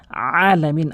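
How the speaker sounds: "আলামিন" with a forceful squeezed ain at the start; pressed ain. Shown as a demonstration. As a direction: lean hard on the throat sound opening "আলামিন". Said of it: "আইনটি ভর দিয়ে উচ্চারণ করবেন". (আইনে ভর দিয়ে "আলামিন")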